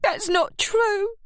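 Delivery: high, slow denial; arguing. A narrator's reading. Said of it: "Kath argued".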